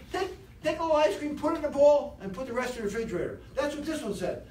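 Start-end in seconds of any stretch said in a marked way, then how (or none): 0.1-3.4 higher, louder, upbeat sensible voice, fast at the end; sensible inner voice. Acted. Speaker voicing the good inner voice about ice cream, upbeat. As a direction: coax yourself toward moderation.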